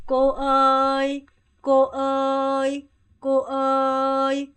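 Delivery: 꼬어이 stretched slowly, level and loud; slow level call. Shown as a demonstration. Teacher models calling her, slow level call. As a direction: call 꼬어이 long and flat. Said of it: "절 부를 때".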